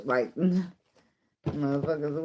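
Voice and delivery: ordinary talk, nothing marked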